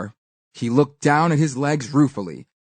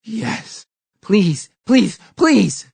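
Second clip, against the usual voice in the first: rising, louder pleading spider; eager begging; 6 semitones higher, 3 dB louder, much slower than usual. A narrator's reading. Greedy spider begs the girl for honey, building up. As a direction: beg faster and louder each time.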